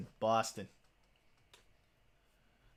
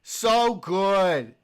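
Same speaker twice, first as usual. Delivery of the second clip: loud, higher mock moaning in pleasure; exaggerated loud mock moan; 4 semitones higher, 10 dB louder, much slower than usual. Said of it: "And it was like".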